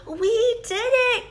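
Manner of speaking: high, excited shout of triumph; joyful cry. Acted. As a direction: celebrate like you finally won. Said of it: "cried Toad".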